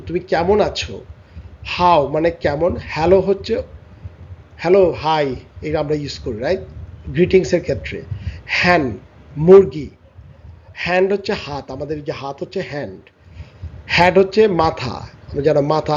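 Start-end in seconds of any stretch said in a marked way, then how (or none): none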